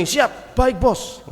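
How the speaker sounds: high, snappy acted reply to the boss; mock-eager subordinate; brisk firm response. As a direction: report for duty, keenly. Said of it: "harus yang kenceng".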